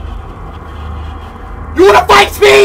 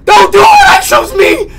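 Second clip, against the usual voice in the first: panicked pleading; 8 semitones higher than usual. Real, not acted. Begging during game event.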